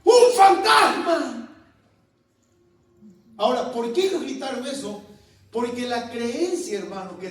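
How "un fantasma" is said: loud, high, drawn-out cry of alarm; shouted cry. Acted. Disciples see a man walking on the water, shouting out. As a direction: shout it slowly as a whole boatful would. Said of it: "que todos gritaron".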